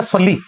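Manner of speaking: सल्ली ending in a clear "lee"; correct long-ee ending. Shown as a demonstration. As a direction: give सल्ली a long "ee", not "ay". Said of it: "लाम जेर ली होता है". (सल्ली, आखिर में साफ "ली")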